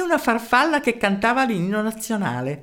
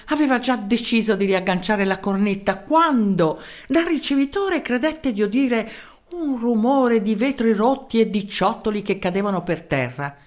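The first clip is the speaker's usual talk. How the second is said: ordinary delivery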